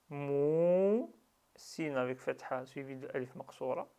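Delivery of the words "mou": "mou" with the vowel stretched long; drawn-out vowel. Shown as a demonstration. Teacher demonstrating the long waw, "mou" held long. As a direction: pull out the "ou" of "mou" in one sustained sound. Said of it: "On tire".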